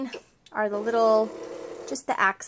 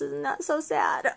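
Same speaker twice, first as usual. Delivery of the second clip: slow, lower, a touch of fake pity; mock sad; 6 semitones lower, 3 dB quieter, slower than usual. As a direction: pretend to mourn it, half joking. Mock lament over pink stuff.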